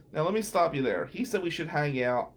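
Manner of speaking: usual speaking voice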